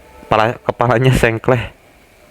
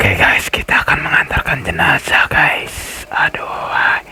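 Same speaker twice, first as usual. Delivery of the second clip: hushed aside while carrying the body; whisper; 3 semitones higher than usual. Real, not acted. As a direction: lean in and whisper to the viewers. Whispering to viewers.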